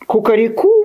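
high, playful cock-a-doodle-doo; rooster crow. A narrator's reading. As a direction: crow it as a teacher demonstrating. Rooster demonstrates his crow.

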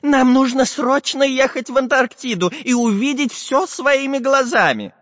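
high, eager professor voice; eager old professor. Acted. Professor's voice held.

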